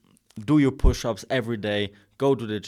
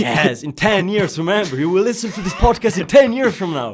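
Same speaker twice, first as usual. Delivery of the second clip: laughing taunt; 9 semitones higher, 11 dB louder than usual. Real, not acted. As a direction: laugh and warn them.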